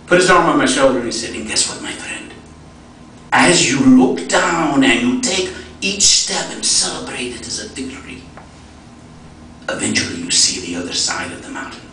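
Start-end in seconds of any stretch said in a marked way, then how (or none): 1.3-11.8 put-on German de Sasa's accent. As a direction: imitate an Ethiopian friend gently delivering the payoff. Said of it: "I do a pretty good German accent".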